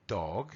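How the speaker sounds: Dog said low with a long rounded o; DOG, long vowel. Shown as a demonstration. Teacher contrasting Dog with duck, low and long. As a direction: draw out Dog, soft g at the end. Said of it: "oís la diferencia".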